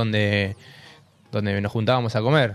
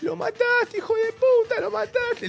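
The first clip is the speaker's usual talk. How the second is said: very high-pitched mock yell; imitated screaming; slower than usual. Woman fighting with the crowd after the crash, imitated screaming. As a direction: play the woman screaming abuse at the driver. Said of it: "le gritaba y se peleaba con la gente".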